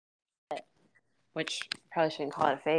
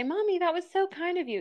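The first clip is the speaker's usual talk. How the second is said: put-on child's voice; 10 semitones higher than usual. Child quoted again.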